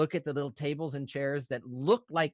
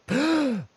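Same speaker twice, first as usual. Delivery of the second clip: loud, sharp gasp; sudden gasp; 9 semitones higher, 8 dB louder than usual. Acted. Halfling coming to after healing, sudden gasp. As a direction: gasp like jolting back to life. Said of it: "you hear".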